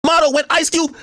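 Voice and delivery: ordinary talk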